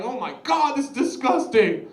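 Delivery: high, loud disgusted outburst; mock revulsion. Viewer watching Steve eat the sofa, mock revulsion. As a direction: recoil in exaggerated disgust. Acting revolted reaction to the video.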